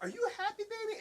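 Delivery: put-on very high sing-song baby voice; dad cooing to his baby daughter, high; baby talk.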